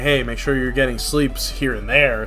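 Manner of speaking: normal talking voice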